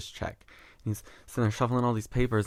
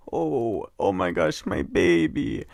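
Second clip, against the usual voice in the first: put-on Russian accent; 2 semitones lower, slower than usual. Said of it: "that was the accent I was getting".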